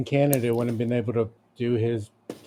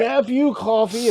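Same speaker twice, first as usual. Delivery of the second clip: screaming; 8 semitones higher, slower than usual. Pretend. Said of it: "Just start screaming".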